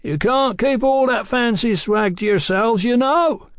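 high, carrying holler; loud, high-pitched jibe; bellowed parting shot. A narrator's reading. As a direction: bellow after the departing men. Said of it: "bellowed as a parting shot".

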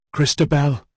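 falling man crying out to his love; desperate cry. A narrator's reading. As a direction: call out to her as you fall.

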